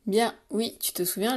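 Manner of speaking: usual speaking voice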